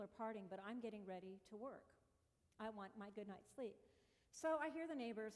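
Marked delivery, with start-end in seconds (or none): none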